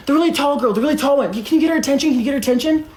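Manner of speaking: high-pitched imitation of a pest; urgent, high mimicry; mock pleading. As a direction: pester strangers in a high mocking voice. Mimics him pestering other VIP guests.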